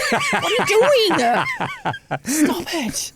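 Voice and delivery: high, slow, laughing mock scold; half-laughing horrified hush at the kids; embarrassed scolding. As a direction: mum laughing as she tells the kids off. Mum scolding kids in shop.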